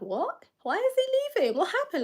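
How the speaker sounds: high-pitched shocked questions; retold shock, voice shooting up. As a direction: reenact your own disbelief at the news. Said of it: "even I was shocked".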